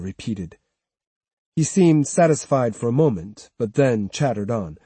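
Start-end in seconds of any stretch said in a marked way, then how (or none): none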